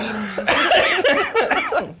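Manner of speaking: high burst of laughter; laughing. Real, not acted. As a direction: laugh out loud. Laughing at dark joke.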